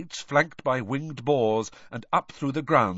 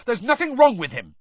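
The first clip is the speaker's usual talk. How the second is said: high, loud, indignant shout; 12 semitones higher, 7 dB louder than usual. A narrator's reading. Harry defending Hagrid to the reporter, shouting. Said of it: "Harry shouted".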